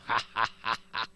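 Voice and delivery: low, deliberate "ha, ha, ha"; a staged villain's scoffing laugh; mocking laugh. A narrator's reading. Demon king scoffing at his son's God, laughing. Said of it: "laughingly said".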